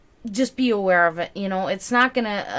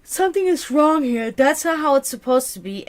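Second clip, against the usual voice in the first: higher, louder voicing of someone spotting a red flag; alarmed realization; 6 semitones higher, 6 dB louder than usual. Tarot reader voicing a client noticing red flags, alarmed. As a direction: act out the sudden sense that something's off. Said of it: "where we can say".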